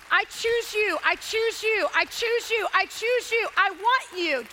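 insistent, punched vows; emphatic declaration. Acted. Preacher's repeated declaration of commitment.

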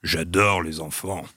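low, slow dragon voice; a deep, slow lucky dragon. Acted. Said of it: "je fasse une petite voix".

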